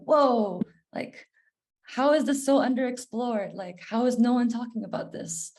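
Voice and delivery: mildly incredulous, voice lifted in disbelief; amazed reaction. Retold or imitated. Speaker recalling discovering financial trauma, amazed.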